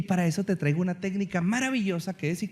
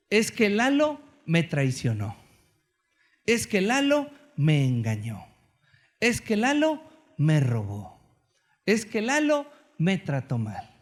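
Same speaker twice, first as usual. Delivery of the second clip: slow, weighted complaint on Lalo; complaining example; slower than usual. Shown as a demonstration. Preacher demonstrates victim complaints about Lalo, slow and weighted. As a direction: lay out each grievance slowly. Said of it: "Fíjate muy bien".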